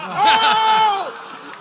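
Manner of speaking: a loud high offended "Oh."; mock offended gasp. Retelling the tech's insult, loud mock-offended gasp. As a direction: act stung and scandalised, laughing. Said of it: "I'm like".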